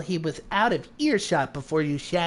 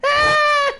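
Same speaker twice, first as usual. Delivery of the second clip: startled yelp; 6 dB louder than usual. Real, not acted. Startled by character appearing.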